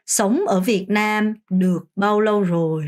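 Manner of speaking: slow, careful Vietnamese question; slow model sentence. Shown as a demonstration. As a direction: model it slowly for the class. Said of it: "라고 하면 됩니다". (천천히 또박또박 시범 문장)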